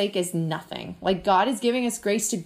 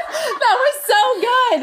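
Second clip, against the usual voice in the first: high, slow laughing praise; 7 dB louder, slower than usual. Real, not acted. Cohost laughs in delight at the story, laughing.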